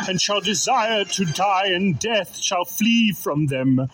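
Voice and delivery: ordinary talk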